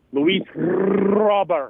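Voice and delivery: Robert with a trilled R, slow and loud; rolled r. Shown as a demonstration. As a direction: roll the R in "Robert" hard and slow.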